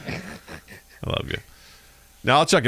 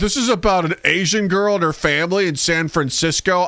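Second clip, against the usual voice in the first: mock whiny viewer; 6 semitones higher than usual. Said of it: "they're like".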